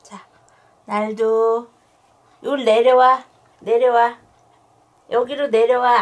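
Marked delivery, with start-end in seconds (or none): 2.4-6.0 loud firm call down; loud dog command. Real, not acted. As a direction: order the dog down, loudly. Calling dog down loudly. (크게 부르는 단호한 명령)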